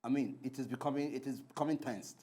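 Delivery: low, soft warning; tense restraint. Real, not acted. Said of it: "I'm becoming tense right now".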